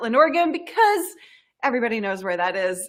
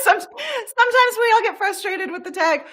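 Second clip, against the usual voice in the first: laughing; 12 semitones higher than usual. Real, not acted. Playful moan about tech frustration.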